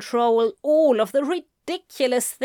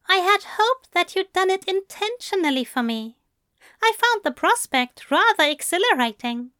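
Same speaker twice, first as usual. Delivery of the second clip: Aziraphale voice; 9 semitones higher, faster than usual. A narrator's reading.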